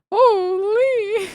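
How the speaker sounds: a high, helpless laugh of disbelief; just break into laughter; incredulous high laugh. Real, not acted. Streamer cracking up at fans' hateful messages.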